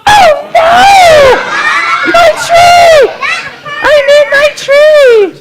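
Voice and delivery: high, loud distressed wail; distressed shout. Acted. Singer panicking over the stolen tree, high loud wail. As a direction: cry out for the lost tree.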